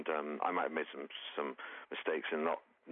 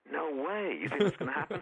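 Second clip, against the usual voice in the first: high-pitched mock incredulity; retold disbelief; 9 semitones higher, 3 dB louder than usual. Comedian recalling his reaction to a co-writer's prediction, incredulous. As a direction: recall being amazed at the very idea.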